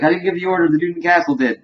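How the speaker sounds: high-pitched acted protest; mock pleading. Dude blamed for killing the family, pleading. Victim pleading before Morty kills him.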